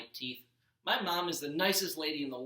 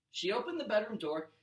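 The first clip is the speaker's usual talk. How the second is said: ordinary delivery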